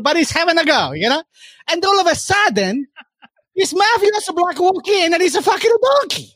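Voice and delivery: ordinary delivery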